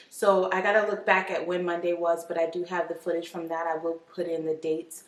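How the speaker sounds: ordinary delivery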